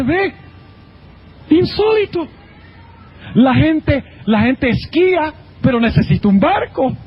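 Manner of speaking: Peter marveling. Retold or imitated. Peter on the water marveling to himself in high astonishment. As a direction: crow with wonder at doing the impossible.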